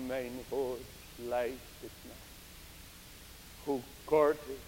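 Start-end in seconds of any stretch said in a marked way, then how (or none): none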